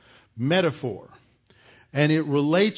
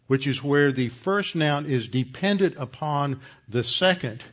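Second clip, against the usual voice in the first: normal talking voice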